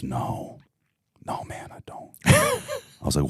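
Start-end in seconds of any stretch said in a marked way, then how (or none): 0.0-2.1 whispered, sheepish no; the bum quietly admits he has no gun, whispered; retold whisper. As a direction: whisper it like the bum caught in a lie.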